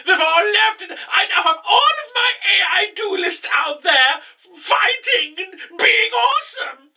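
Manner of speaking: very high, strained mock boasting voice; hammed-up villain boast. Mocking the boss's triumphant boast, squeaky and high. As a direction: crow about victory in a squeaky villain voice.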